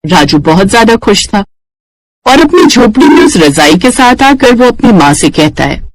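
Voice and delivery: usual speaking voice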